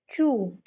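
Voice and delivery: "चू" with a ch, like "chew"; correct "ch" sound. Shown as a demonstration. Teacher showing kids how TU is read, "चू" with ch. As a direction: say TU as "चू", starting on ch. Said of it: "इसको हम पढ़ते हैं".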